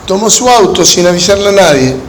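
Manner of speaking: ordinary delivery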